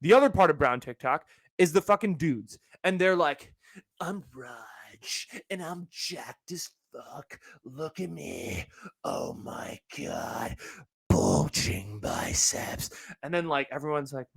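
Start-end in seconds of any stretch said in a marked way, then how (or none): 4.0-12.9 slow, very soft, whispery show-off; mock thirst-trap guy. Podcaster mocking buff TikTok dudes, slow whispery voice. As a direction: drag it out in a hushed, self-admiring whisper.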